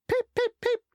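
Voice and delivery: very high, loud peep peep; cheeky whistle. A narrator's reading. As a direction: toot back cheekily. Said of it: "said cheeky Thomas".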